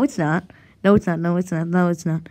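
ordinary talk

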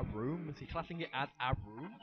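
normal talking voice

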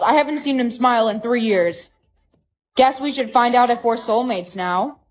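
ordinary talk

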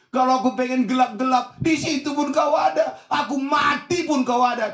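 high, loud cry of wonder to God; impassioned declaration. Acted. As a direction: build up to a near shout on each phrase. Preacher dramatizes David's cry. (seruan berapi-api dengan nada tinggi)

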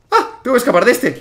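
high, loud yelp into speech; startled alarm. Real, not acted. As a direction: cry out as the chaser appears. Monster appears, must escape.

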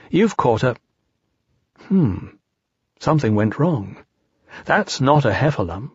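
ordinary delivery, nothing marked

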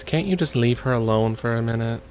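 usual speaking voice

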